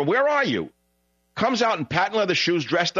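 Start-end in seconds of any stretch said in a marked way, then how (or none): 0.0-0.7 high-pitched mock yell; retold scream. Host imitating partygoers screaming for the boat owner, mock yell. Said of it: "They're screaming for".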